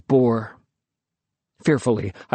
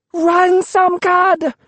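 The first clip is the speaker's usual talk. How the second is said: high, loud yell while fleeing; 5 dB louder, much slower than usual. A narrator's reading. Lukey yells to Samkad over her shoulder, loud yell. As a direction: scream a warning as you run away. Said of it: "she yelled over her shoulder".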